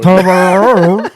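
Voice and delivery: Toblerone loud, high and drawn out; exaggerated sounding out. Shown as a demonstration. Host hammering the name home to a baffled player, loud and slow. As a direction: stretch Toblerone out loudly, lifting the pitch. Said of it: "Sound it out".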